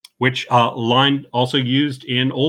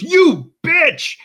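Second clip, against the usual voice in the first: sudden yelp, much higher and louder; mock outburst; 4 dB louder than usual. Podcaster quoting Ron's outburst at Veronica, high mock yell. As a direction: fling the insult like a betrayed anchor. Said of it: "Ron immediately just goes".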